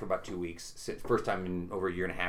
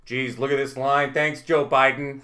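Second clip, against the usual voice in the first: loud; 3 semitones higher, 7 dB louder, slower than usual. Retold or imitated. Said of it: "he was just being real loud".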